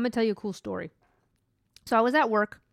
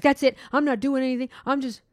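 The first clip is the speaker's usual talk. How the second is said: higher-pitched, mildly fed-up mimicry; imitating upset coworker; 4 semitones higher, 4 dB louder than usual. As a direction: act out someone throwing in the towel. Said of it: "She got upset".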